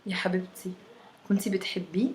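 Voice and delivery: soft, moved sympathy; moved, tender. A narrator's reading. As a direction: ask it softly, full of sympathy. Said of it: "قالت بصوت متأثر".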